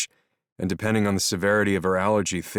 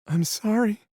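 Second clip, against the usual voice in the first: put-on scared young woman. Melody apologising, scared.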